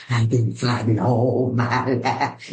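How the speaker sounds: low, slow, weighty movie-drama voice; deep, drawn-out mock-dramatic declaration; Color Purple impression. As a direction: drop low and ham up a film monologue. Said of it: "I did some Color Purple".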